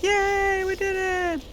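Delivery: imitating his own giddy cheer. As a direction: celebrate like a thrilled kid. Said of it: "that's literally what I do and say".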